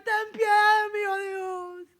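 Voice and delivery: high, wailing cry for mercy; imitated desperate, crying prayer; tearful plea. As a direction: re-enact sobbing out a prayer. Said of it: "Y yo solo decía".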